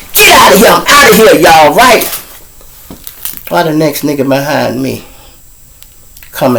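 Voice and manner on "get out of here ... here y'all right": loud, high, triumphant "get out of here"; loud, high, gleeful getaway; gleeful exit. Retold or imitated. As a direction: celebrate walking free. Leaving court triumphant.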